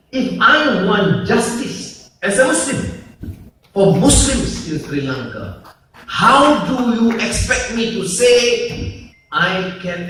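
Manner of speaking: loud, high oratorical challenge; impassioned challenge. Real, not acted. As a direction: throw the question out to the whole hall. Impassioned challenge in a public speech.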